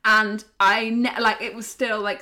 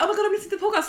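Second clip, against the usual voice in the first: excited fan; 11 semitones higher, much faster than usual. Retold or imitated. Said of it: "people are like".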